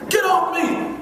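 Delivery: high mock yell of a struggling kid; angry kid yell. Boy pinned by staff yelling at them, high mock yell. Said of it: "I'm all like".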